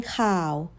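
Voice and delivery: khao kept low and soft; sit low on khao. Shown as a demonstration. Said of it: "news sits down low".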